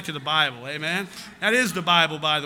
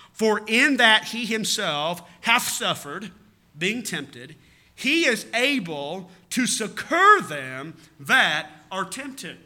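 slow, solemn reading of a Bible verse; slow King James verse read from the pulpit; scripture reading; 3 semitones higher, 5 dB louder, much slower than usual. Acted. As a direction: read it out slowly and solemnly, as holy text. Reads scripture aloud.

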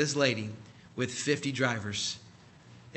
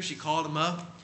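normal talking voice

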